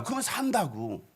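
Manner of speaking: normal talking voice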